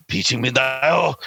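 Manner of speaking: slow, hammed-up Mexican accent. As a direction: drag it out in a mock Mexican accent. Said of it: "give him a Mexican accent".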